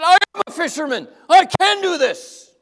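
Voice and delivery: a proud fisherman crowing; triumphant. Acted. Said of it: "Peter's thinking".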